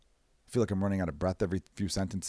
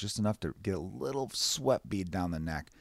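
ordinary delivery

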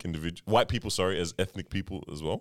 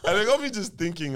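laughing; 9 semitones higher than usual. Real, not acted. Laughing through story.

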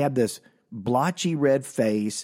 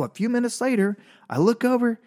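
normal talking voice

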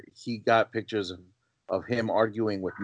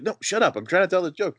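lightly imitated, pitched higher, brushing them off; a hint of an eager guy who won't be interrupted; shooing off interruptions to finish the joke; impatient joke teller; 9 semitones higher than usual. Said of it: "And she's like".

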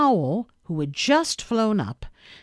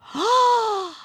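sharp gasp; 5 dB louder than usual. A narrator's reading. Christopher Robin realizes the idea.

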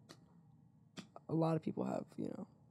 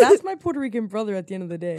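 hyped; 2 semitones higher, 9 dB louder, faster than usual. Real, not acted. Hyped claiming kinship.

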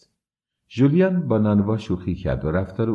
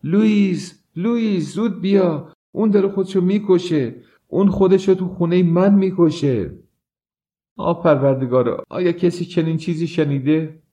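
much higher and louder, calling in terror; panicked screaming; 10 semitones higher, 4 dB louder than usual. A narrator's reading. Nana screaming for Louis in terror as George dies. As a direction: scream for Louis to come now. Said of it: "از این رو فریاد زد".

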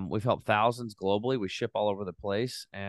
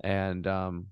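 usual speaking voice

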